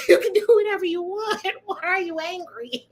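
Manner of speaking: amused disbelief with laughter; laughing question. Real, not acted. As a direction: ask the god while giggling. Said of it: "my funny bone is on tonight".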